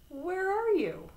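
very high searching call; anxious call. A narrator's reading. Toad searching the dark theater, calling anxiously. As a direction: call out for a lost friend.

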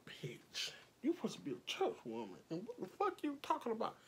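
whispered, quick, scornful aside; hushed retort. Retold or imitated. Teller recalling her muttered comeback to the church woman, hushed scornful aside. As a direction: hiss it under your breath at her.

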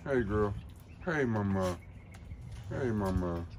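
ordinary talk, nothing marked

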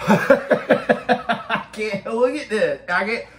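snotty crying. Real, not acted. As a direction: whine it through a runny nose. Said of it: "my nose is running".

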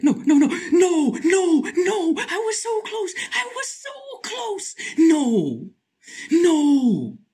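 high, loud despairing no's; wailing in defeat; anguished wail. Real, not acted. Gets caught, so close.

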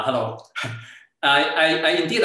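normal talking voice

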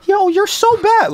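squeaky high mock scold; tiny high voice telling the dog off; wrong high scolding. Shown as a demonstration. Demo of the wrong high way to scold a dog. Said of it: "you're not gonna go".

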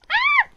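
sudden very high, loud shriek; startled yelp. Real, not acted. Vlogger startled mid-craft, shrieking high. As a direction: let out a short high scream.

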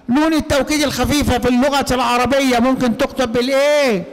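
normal talking voice

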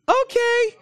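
squeaky high timid okay; meek husband voice. Retold or imitated. Husband giving in to his wife, squeaky high timid okay. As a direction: play the cowed husband, tiny and high. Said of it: "the guy's like".

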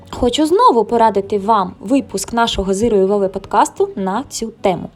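usual speaking voice

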